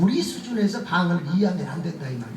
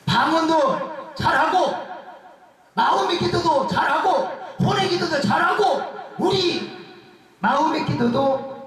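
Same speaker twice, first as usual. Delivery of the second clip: preaching at full force; 7 semitones higher, slower than usual. Acted. Preacher urging the congregation to pray every way, at full force. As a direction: drive it home to the congregation.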